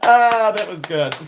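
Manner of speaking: laughing it out, loud; big real laugh; real laughter, loud and high. Real, not acted. Laughing at a comment.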